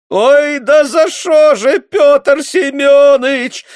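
high, loud, slow sobbing plea; sobbing wail. A narrator's reading. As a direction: wail in despair. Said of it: "зарыдала женщина". (рыдающий вопль)

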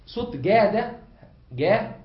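جا with a hard g, between k and j; kaf-jim blend. Shown as a demonstration. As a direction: sound out جا as a hard g. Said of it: "صوت مركب من صوت".

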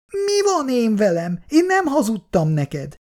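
high-pitched affronted retort; acted girlish indignation, pitched high; indignant outburst. A narrator's reading. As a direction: flare up in high-voiced protest. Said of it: "csattant fel Icu".

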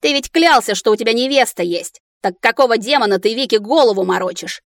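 ordinary delivery, nothing marked

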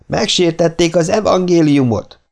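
outraged monk crying out high and loud. A narrator's reading. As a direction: cry it out in holy outrage, high. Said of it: "kiáltotta a szerzetes harsány hangon". (felháborodott, harsány kiáltás)

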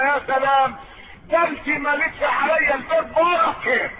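butter up the wife cheerfully; sweet-talking husband. Acted. A husband greets his wife in the morning, sweetly praising.

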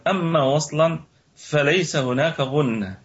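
ordinary talk

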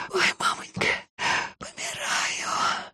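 breathless whisper of pain; whispered moan. Acted. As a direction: moan it almost voiceless, dying.

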